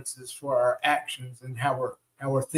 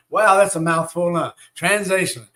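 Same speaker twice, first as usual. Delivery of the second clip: normal talking voice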